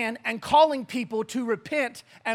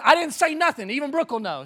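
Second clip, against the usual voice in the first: ordinary talk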